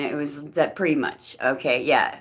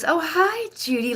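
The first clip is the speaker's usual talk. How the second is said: mock friendly greeting; 11 semitones higher than usual. Ex greets her as if known.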